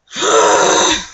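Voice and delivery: long, loud, exasperated exhale; real weary sigh; heavy sigh. Real, not acted. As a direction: blow out the frustration in one breath. Fed up mid-rant.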